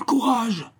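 very high-pitched distant call; crying out. A narrator's reading. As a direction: call encouragement across the water, high and stretched. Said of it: "cria Raoul".

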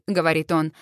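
usual speaking voice

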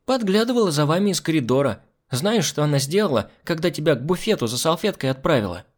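put-on high-pitched tattling child's voice; pert little girl. Girl telling the man what she spied, pert. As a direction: confide it like a cheeky little informer.

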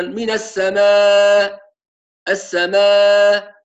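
السماء with the hamza faded, drawn out; السماء said with a barely there hamza; weak hamza. Shown as a demonstration. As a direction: stretch السماء and let the final hamza melt away. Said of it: "يضعف الهمزة كثيرًا فيقول مثلًا".

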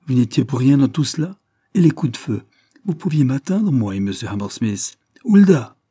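shocked reproach, rising to a cry; astonished reproach. A narrator's reading. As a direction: cry out in disbelief and blame. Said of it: "de l'étonnement et du reproche dans la voix".

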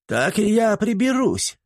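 put-on high, slow jumpy old man; old servant leaping up; eager old servant. A narrator's reading. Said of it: "вскочил Флаппий".

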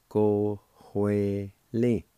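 slow, "go hue li" drawn out syllable by syllable; slow demo. Shown as a demonstration. Teacher sounding out the second part of the word, slowly. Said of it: "Second part".

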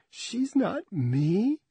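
slow, high tearful plea; slow tearful words from a crying wife. Acted. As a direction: hold back a sob on the phone. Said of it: "His wife is crying".

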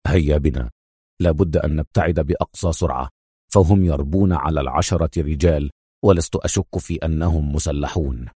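low, resolute urging; firm. A narrator's reading. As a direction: take charge in a low, firm voice. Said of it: "يستطرد في حزم".

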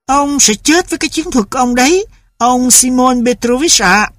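a wife mocking her husband; sarcastic retort. A narrator's reading.

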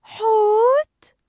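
very high, loud, drawn-out surprise; wide-open incredulous squeal; astonished question. A narrator's reading. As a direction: stretch the word out high in amazement. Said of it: "بدت دوري مندهشة وسألت".

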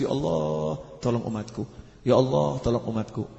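low, hushed retold plea; tearful prayer. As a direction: softly imitate pleading through tears, without sobs. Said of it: "dan menangis".

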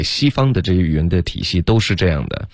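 normal talking voice

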